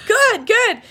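high, loud encouragement; cheering on. Retold or imitated. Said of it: "I said".

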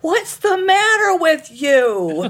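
drawn-out, high, loud mock yell; loud, indignant imitation of a family row; imitated family yelling. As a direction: yell it slowly like an angry relative. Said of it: "they say it loudly".

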